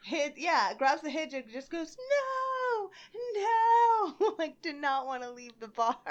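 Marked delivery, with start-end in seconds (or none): 2.0-4.3 high, drawn-out, loud wail; mock-cry it, refusing to be dragged out; imitated wailing refusal. Acting woman clinging to the door.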